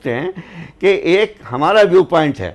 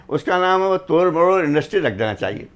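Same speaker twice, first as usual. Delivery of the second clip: ordinary talk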